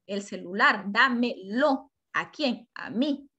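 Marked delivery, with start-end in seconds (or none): 0.9-1.9 "dámelo" drawn out slowly; slow teaching demonstration of "dámelo"; syllable by syllable. Shown as a demonstration. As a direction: stretch out each syllable of "dámelo". Teacher building word from syllables.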